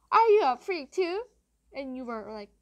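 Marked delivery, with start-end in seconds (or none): 0.1-1.2 loud, high-pitched mock outburst; mocking imitation. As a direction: imitate someone's indignant challenge, loudly. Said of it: "He was like".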